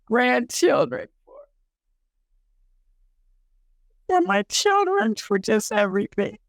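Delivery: fighting back tears, long halting pauses; choked up. Real, not acted. Woman recalling thanking God for her grandchildren, choked up. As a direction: voice rising and breaking with real tears. Tearful thanks, apologises for breaking down.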